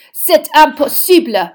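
high, loud, slow exclamation; outraged French headmistress. Acted. Headmistress objecting to two champions, outraged. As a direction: stretch out the indignant French protest.

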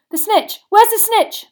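high, loud urgent question; bellowing. A narrator's reading. Brother searching for the snitch, urgent bellow. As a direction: bellow along the row. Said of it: "bellowed Charlie".

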